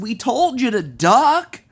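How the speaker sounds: loud, high and slow teasing; smug high-pitched taunt; mocking friends. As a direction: rub it in, drawn out and loud. Friends tease him after fall.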